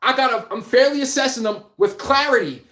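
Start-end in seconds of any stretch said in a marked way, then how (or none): none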